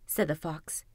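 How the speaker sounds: usual speaking voice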